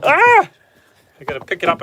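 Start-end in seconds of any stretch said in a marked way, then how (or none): 0.0-0.5 sudden high startled yelp as it slips. Real, not acted. Farmer dropping the bottle, a startled yelp. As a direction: caught off guard, a quick jump in pitch.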